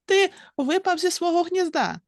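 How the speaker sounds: high, startled squirrel cry; squirrel cries out. A narrator's reading. Curie the squirrel crying out to the bird, high. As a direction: call it out high in surprise. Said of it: "закричала білочка".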